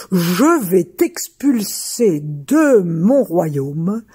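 a giant rumbling out threats; dim slow booming giant. Acted.

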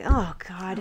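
quiet exasperated aside; mock exasperation. Friends react to boyfriend's toast, mock exasperation. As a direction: groan it under your breath.

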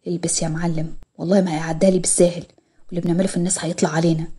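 low, softly spoken grumble to himself; rueful husband. A narrator's reading. Husband grumbling to himself about Murad's payback, low and rueful. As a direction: keep it down, an uneasy husband's aside. Adam's muttered aside in narrated story.

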